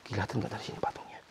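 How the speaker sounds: fast whispered question. Real, not acted. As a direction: whisper it quickly to yourself. Hushed question, unvoiced. (berbisik cepat)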